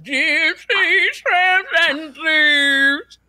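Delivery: [name]. Retold or imitated. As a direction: sing it out like [name]. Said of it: "So she's [name] now?".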